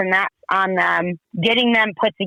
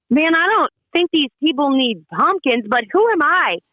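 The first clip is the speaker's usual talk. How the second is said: higher, wry self-doubt, half to herself; doubtful inner thought; 6 semitones higher than usual. Retold or imitated. Telling the host her private doubt, lightly skeptical and higher. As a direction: question the idea with a skeptical lift. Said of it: "I'm thinking to myself".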